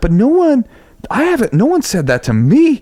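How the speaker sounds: high, incredulous halting voice; retold astonishment. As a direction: imitate a stunned star who is never scolded. Voicing [name]'s astonished face.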